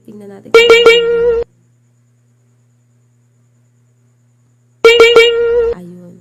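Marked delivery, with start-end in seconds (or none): 0.6-5.4 loud ringing ding; played ding chime; bell sound effect. Shown as a demonstration. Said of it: "meron ditong din-din".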